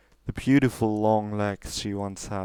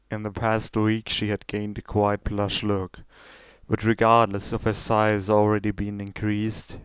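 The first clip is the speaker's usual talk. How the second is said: usual speaking voice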